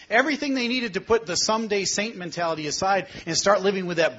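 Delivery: loud, rising preacher's climax; preaching at full force. Acted. Pastor drives the point home to his flock, loud preaching. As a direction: preach it hard to the congregation.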